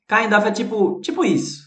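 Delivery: ordinary talk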